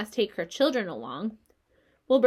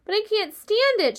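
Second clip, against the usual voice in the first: much higher, louder protest; distressed shout; 11 semitones higher, 6 dB louder than usual. A narrator's reading. Wilbur refusing to be calmed by Charlotte, distressed shout. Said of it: "shouted Wilbur".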